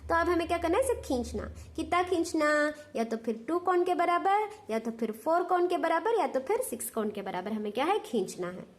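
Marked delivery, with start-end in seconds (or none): none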